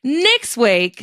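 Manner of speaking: ordinary talk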